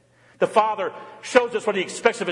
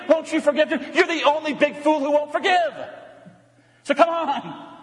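higher, urgent pleading father begging his son; 9 semitones higher than usual. Acted. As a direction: coax the son to come and forgive. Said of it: "The father pleads".

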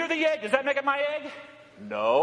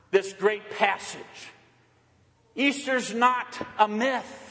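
raised, slowed, emphatic proclamation; preaching at full force; 5 semitones higher than usual. Acted. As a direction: proclaim the Easter truth to the congregation.

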